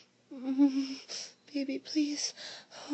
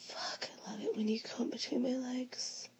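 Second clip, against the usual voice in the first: whispering; 3 semitones higher than usual. Acted. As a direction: whisper it close to the ear.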